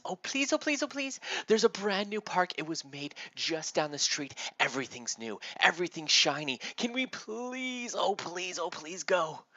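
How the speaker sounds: put-on high, eager kid begging; pleading excited child. Timmy begs his mother to go to the park, pleading excitedly. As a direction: beg in a bubbly, high-pitched rush of excitement.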